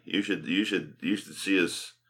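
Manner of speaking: ordinary delivery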